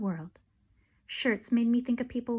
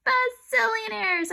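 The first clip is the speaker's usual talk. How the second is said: high, slow, louder shout; excited shout; 11 semitones higher, 4 dB louder, slower than usual. Acted. Narrator topping everyone, high and slow. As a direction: stretch it out gleefully. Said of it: "I shouted".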